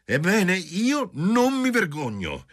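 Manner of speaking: tipsy exclaiming. A narrator's reading. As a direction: tease him boldly.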